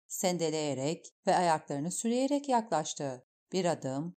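ordinary delivery

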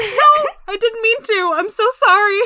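high, loud, pleading mock apology; flustered apology.

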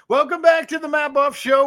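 loud, high radio host welcome; big booming talk radio opener; host intro. Acted. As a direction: belt out the show opener like a DJ. Radio host opening the show.

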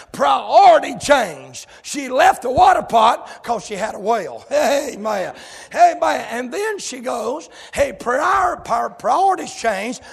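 loud, rising sermon cadence; full-force preaching. Acted. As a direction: preach it hard to the congregation.

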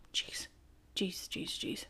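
whispered under the breath; very soft, exasperated aside. Real, not acted. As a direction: mutter it almost soundlessly in disbelief.

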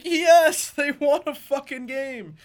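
loud, high, slow-stretched triumph; re-enacted victory cheer. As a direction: relive the win with a big drawn-out cheer. Re-enacts cheering a rare win.

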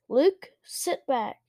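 usual speaking voice

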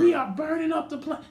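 high-pitched worried mama imitation; retold motherly alarm in a high voice; mama's voice. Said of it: "my mama was talking about that".

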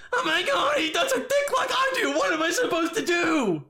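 high, whiny fake-crying activist; mocking Twitter activist. Podcaster mocking a Twitter activist with a high, whiny fake cry. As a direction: wail it like a melodramatic activist. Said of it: "social activist on Twitter".

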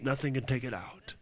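ordinary talk

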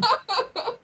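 high, giggly burst of laughter; real laugh. Real, not acted. Guest about to tell a good London anecdote, high giggle. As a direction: giggle high before starting the story.

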